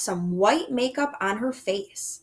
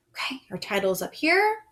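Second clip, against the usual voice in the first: whispered; 3 semitones higher than usual. Acted. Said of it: "whispered aside".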